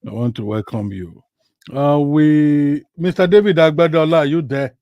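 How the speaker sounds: ordinary talk, nothing marked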